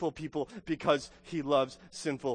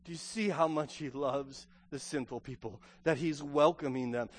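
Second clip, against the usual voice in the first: ordinary talk, nothing marked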